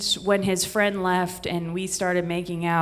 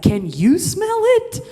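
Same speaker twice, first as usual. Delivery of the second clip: high, slow, mocking echo of the question, laughing; incredulous high mock echo; 12 semitones higher, 4 dB louder than usual. Boyfriend mocking her question in a high, slow voice. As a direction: throw her question back at her, squeaky and amazed. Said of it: "And he's like".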